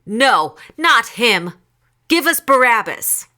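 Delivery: high, loud angry-crowd shout; shouting back. A narrator's reading. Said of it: "They shouted back".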